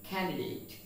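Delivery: Candidate with an aspirated K; correct pronunciation. Shown as a demonstration. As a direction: say Candidate as "KHAN-di-date". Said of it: "the pronunciation is candidate".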